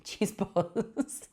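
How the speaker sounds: ordinary delivery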